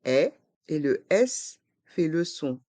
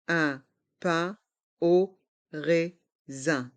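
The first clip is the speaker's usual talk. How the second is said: slow "Un pain au raisin", every syllable apart; learner-paced, split "Un pain au raisin"; slower than usual. Shown as a demonstration. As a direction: separate each sound of "Un pain au raisin". Teacher breaks phrase into syllables.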